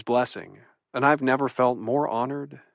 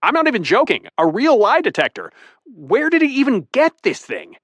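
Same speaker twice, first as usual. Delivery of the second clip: plain reading voice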